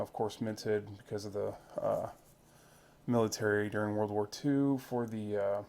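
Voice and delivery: normal talking voice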